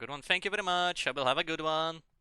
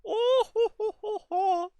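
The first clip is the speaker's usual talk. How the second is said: very high mock "ho ho" chuckle; hammed-up, high-pitched German ho-ho laugh; cartoon German laugh; 6 dB louder, slower than usual. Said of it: "he had a funny German accent".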